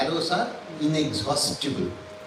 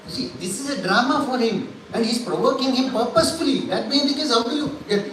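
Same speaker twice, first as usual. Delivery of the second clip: usual speaking voice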